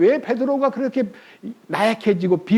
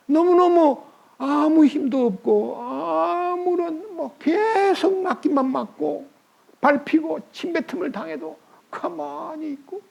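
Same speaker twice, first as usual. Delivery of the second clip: tearful; 8 semitones higher, slower than usual. Real, not acted. Preacher near tears describing.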